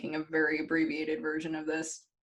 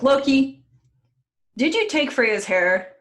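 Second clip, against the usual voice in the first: raised, louder and higher confronting voice; mock angry accusation; 7 semitones higher, 8 dB louder than usual. Retelling the gods confronting Loki, loud mock accusation. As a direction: call the culprit out, drawing the question out.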